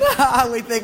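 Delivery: ordinary delivery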